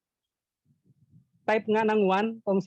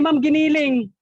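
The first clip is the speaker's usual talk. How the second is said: much higher, louder punchline; 9 semitones higher, 8 dB louder than usual. Retold or imitated. Juan's punchline answer to the teacher, loud and high. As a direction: deliver the punchline big.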